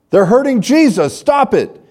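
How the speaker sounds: put-on very high, loud furious child's scream. Girl screaming at soldiers hurting Jesus, high angry shriek. Child protesting the arrest scene.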